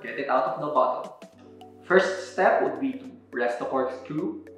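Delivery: ordinary delivery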